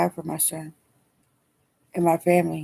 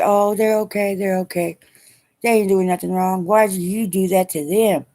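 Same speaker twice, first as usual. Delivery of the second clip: louder, higher, defensive mom voice; imitating mom; 3 semitones higher, 7 dB louder than usual. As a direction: jump in as the mother brushing it off, louder. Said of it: "I can tell my mom, and she".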